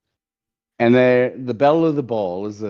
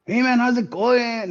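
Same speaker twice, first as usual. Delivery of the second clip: put-on Mexican accent; 14 semitones higher than usual. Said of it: "Like a Mexican".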